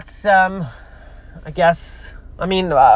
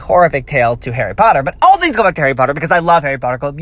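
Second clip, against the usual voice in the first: ordinary talk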